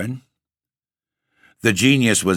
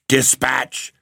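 higher-pitched acted command; furious cry; 6 semitones higher than usual. A narrator's reading. The figure urges the Baron on, mildly furious cry. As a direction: cry it through gnashing teeth, mildly furious. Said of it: "cried the figure, gnashing his teeth".

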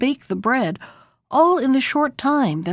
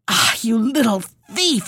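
narrated witch's accusing exclamation; exclaiming witch. A narrator's reading. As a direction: exclaim it as a storybook witch catching a thief. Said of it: "she exclaimed".

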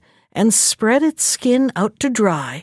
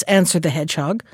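ordinary delivery

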